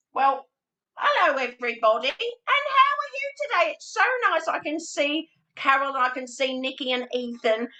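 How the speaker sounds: very high, bright-eyed puppet greeting a class; squeaky little puppet saying hello to each child; Spencer puppet voice. Shown as a demonstration. Teacher voicing Spencer the string puppet to greet children, high puppet voice. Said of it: "So Spencer talks like that".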